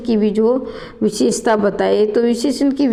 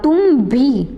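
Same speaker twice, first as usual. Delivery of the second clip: भी punched higher in "तुम भी"; stress on भी; 4 semitones higher, slower than usual. Shown as a demonstration. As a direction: put the weight on भी. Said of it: "बी पर बल दिया गया". (भी पर ज़ोर देकर)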